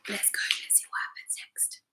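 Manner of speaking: teasing whisper. Shown as a demonstration. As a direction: lean in and whisper. Teacher whispers to children.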